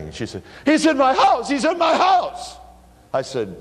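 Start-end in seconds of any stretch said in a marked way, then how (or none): 0.6-2.6 high, loud panicked cry from the scared neighbor. Retold or imitated. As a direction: shriek it like a terrified woman with a burglar inside. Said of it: "She is absolutely panicking".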